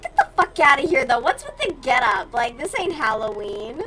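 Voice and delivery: loud, fast incredulous outburst; mock outraged yell. Telling chat how she reacted to the cowboy crowd, loud mock outrage. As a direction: blast it like the cowboy crowd is a joke. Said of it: "And I'm just like".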